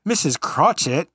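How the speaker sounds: pastor's hammed-up English "Missus Cratchit", drawn out; a slow, mock English "Missus Cratchit"; mock English accent. The pastor at the party calls out in a mock English accent. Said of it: "he wants to do an English accent".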